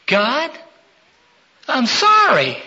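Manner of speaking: high, loud, halting prayer; acted child's plea. Daughter prays to God at bedtime, pleading. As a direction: act a child crying out in apology.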